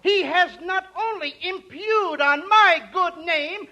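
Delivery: usual speaking voice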